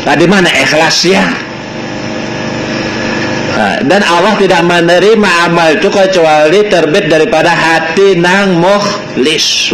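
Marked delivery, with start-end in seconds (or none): none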